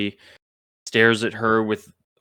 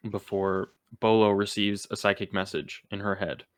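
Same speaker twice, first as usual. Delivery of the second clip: ordinary talk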